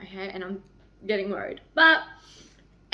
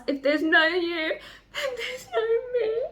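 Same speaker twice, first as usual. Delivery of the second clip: voice breaking; 11 semitones higher than usual. Real, not acted. Reading the ending aloud, crying.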